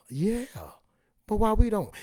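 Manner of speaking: ordinary talk, nothing marked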